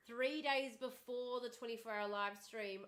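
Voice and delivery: ordinary talk, nothing marked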